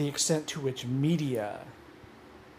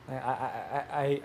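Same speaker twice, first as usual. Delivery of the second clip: normal talking voice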